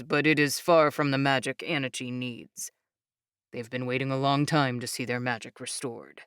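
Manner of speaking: low, calm proud explainer voice; proud young man. Acted. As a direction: speak low and proudly steady. Jake explains.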